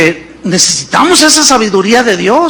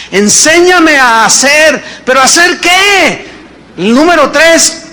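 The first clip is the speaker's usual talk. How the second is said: loud, slow, high preaching call; preaching shout; 6 semitones higher, 3 dB louder than usual. Real, not acted. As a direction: proclaim it to the congregation. Preaching at full force. (predicando a gritos a la congregación)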